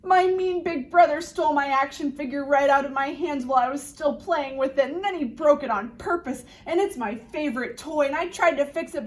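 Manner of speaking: put-on high, fast tumbling complaint of an upset kid; upset little boy. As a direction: pour it all out in one breath. Upset boy pours out troubles.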